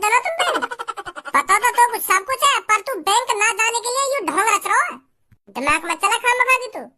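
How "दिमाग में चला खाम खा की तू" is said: ordinary talk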